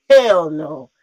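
loud burst on a retold refusal; livid refusal. Woman retelling her reaction to the man's nerve, livid. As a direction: punch it out loud, indignant. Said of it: "I was so livid".

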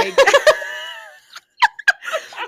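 very high, loud laughing shriek; real helpless laughing shriek. Real, not acted. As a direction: scream with laughter. Shrieking laugh at wig story.

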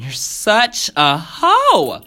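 high, loud, drawn-out accusation; heated blurt. A narrator's reading. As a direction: blurt it out slowly and loudly, fed up. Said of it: "he blurts".

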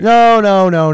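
high, loud protesting no; quick denial. Real, not acted. Accused of defending Connecticut, he protests loudly. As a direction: shoot the accusation down fast.

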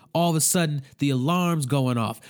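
ordinary talk, nothing marked

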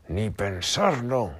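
high, slow defiant shout. A narrator's reading. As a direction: refuse loudly. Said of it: "gritó".

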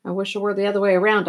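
usual speaking voice

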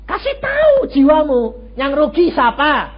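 slow, loud, high preaching; slow exhortation. Acted. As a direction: drive the question home slowly. (seruan khotbah yang lambat)

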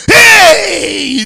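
long, high "hey" of alarm; acted yelp of people fleeing; mock panic cry. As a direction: cry out in exaggerated fright. Mimics frightened churchgoers fleeing.

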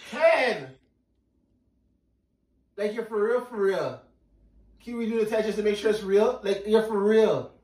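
high, loud, overjoyed and breathless; overjoyed disbelief. Real, not acted. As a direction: shout your disbelief with joy. Recorded overjoyed husband.

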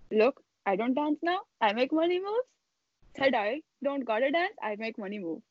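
put-on repeating the lines in an Indian accent; the last sentence again, Indian accent laid on; a hammed-up Indian accent, rapped again; mock Indian accent. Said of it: "can you do the last sentence again".

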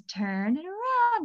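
put-on child repeating the therapist's instruction; kid echoing. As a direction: parrot the words back like a small child. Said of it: "And he goes".

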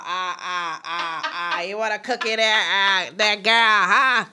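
lightly voiced drunk stutterer, I-I-I repeated; half-laughing mock of a drunk stammer; imitating stuttering drunk. Cousins mimicking grandma's stuttering drunk ex-boyfriend, mock stammer. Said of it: "acting like we were him".

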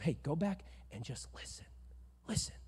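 hushed fatherly advice; soft whispered counsel. Retold or imitated. Eli counseling young Samuel, whispering.

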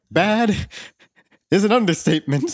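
high mock-indignant reply; retold exasperation. Worker answering boss about the finale, mock exasperation. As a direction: throw it back high, in mock outrage. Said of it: "I'm like".

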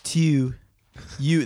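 mock Baltimore vowel on YOU; Baltimore accent. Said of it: "People from Baltimore have the worst accent".

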